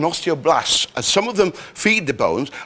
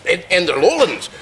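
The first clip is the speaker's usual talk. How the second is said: guttural Lowland Scots, raised pitch; guttural Scottish accent; 3 semitones higher than usual. Shown as a demonstration. Demonstrating accents of the Scottish lowlands, guttural Scots. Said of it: "The very thing's very guttural".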